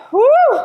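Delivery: loud, high "woo"; effort whoop. Real, not acted. Coach working through reps, loud high whoop. Exertion during countdown.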